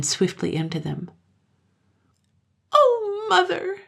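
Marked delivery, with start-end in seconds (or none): 2.7-3.8 very high tearful cry; acted little-girl wail; sobbing child. A narrator's reading. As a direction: sob it out to mother. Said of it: "she sobbed".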